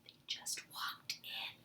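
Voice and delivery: slow, unvoiced whisper; mouthed. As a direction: mouth it silently to the boys. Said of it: "She mouthed the words".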